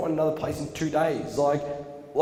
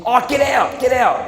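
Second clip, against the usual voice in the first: much higher and louder, shouted order; furious get out; 11 semitones higher, 9 dB louder than usual. Retold or imitated. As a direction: throw him out in front of everyone. Principal marches in, ejects him.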